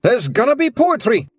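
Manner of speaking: high, loud alarmed cry; yelled warning. A narrator's reading. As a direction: warn everyone loudly. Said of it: "he yelled".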